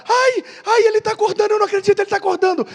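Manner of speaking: high, breathless mock excitement; excited girlfriend. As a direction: gush like a girlfriend seeing him wake up. Girlfriend in retold prank video. (namorada empolgada, voz bem aguda)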